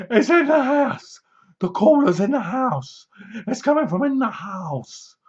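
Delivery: high, urgent warning in a put-on voice; hammed-up high cry of alarm; mock alarmed shout. Bloke on the phone warns her, mock panicked shout.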